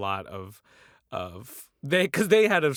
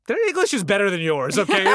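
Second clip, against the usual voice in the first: louder, higher, snapping back in defense; indignant retort; 10 semitones higher, 10 dB louder than usual. Retold or imitated. Son to classmates mocking his parents' accent, loud indignant retort. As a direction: put them in their place, loud and sharp.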